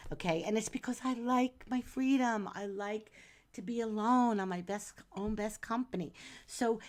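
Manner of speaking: usual speaking voice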